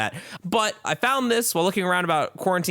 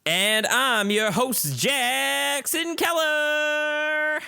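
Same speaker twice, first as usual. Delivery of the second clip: slow drawn-out cowboy twang, voice up high; hammed-up rodeo drawl, pitched high; unplaceable rodeo accent; 11 semitones higher, much slower than usual. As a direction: stretch your own name out like a rodeo announcer. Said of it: "I have no idea what that accent was".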